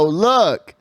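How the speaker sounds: ordinary delivery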